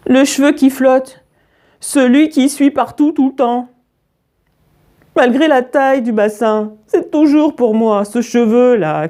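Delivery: put-on plaintive high child voice; tearful child. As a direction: whine it out like an upset child. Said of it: "en pleurant à gros bouillons".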